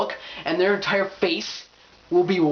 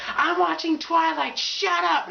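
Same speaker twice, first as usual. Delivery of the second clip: slow, high-pitched fangirl outburst; mock irritated girlfriend; 12 semitones higher, slower than usual. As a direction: shut them up in a high mock snap. Acting girlfriend snapping at boyfriend.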